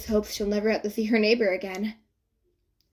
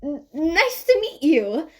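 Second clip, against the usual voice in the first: flustered greeting; 7 semitones higher, 5 dB louder, slower than usual. A narrator's reading.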